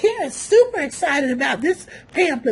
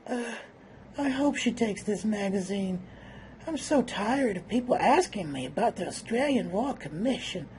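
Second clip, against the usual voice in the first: much softer, low, weary thoughts; weary inner voice; 4 semitones lower, 8 dB quieter than usual. Pretend. Reluctant husband in photo thinking to himself, softer and low. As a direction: think it under your breath, worn out.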